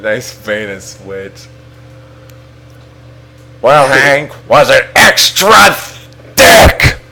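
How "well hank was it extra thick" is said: hammed-up colonel voice, rising at the end; Colonel Sanders. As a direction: put on a showy character voice, punching the last words. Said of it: "like, Colonel Sanders' voice".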